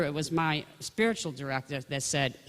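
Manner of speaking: normal talking voice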